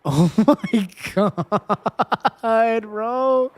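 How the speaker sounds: slow, laughing oh my god; laughing through the words; laughing disbelief. Real, not acted. Laughing at in-game event.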